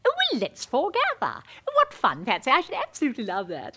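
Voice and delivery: usual speaking voice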